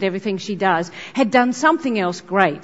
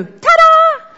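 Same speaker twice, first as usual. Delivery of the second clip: high, loud celebratory shout; triumphant little kid; 7 dB louder than usual. Retold or imitated. Little kids showing off to grown-ups, triumphant shout. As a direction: burst out with a showy ta-da. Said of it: "when little kids go".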